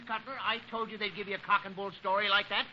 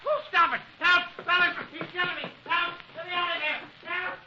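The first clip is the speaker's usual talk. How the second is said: high, panicked yelling for help; panicked cries; 9 semitones higher, 3 dB louder than usual. Acted. Chiggers begging the fellas for help, panicked yelling. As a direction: scream for help while being beaten.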